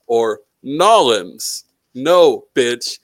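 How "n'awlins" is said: "Nolans" run together as NAW-linz; mock drawn-together "Nolans"; slurred local pronunciation. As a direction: squash the city name into two syllables, "Nolans". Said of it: "When people say".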